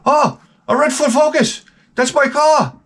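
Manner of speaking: high-pitched mock Italian accent. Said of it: "I don't know if that's an Italian accent".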